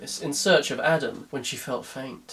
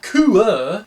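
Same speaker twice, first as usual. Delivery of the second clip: put-on Somerset accent; 5 semitones higher, 7 dB louder than usual. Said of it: "they all speak like that down there".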